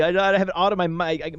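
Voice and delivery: usual speaking voice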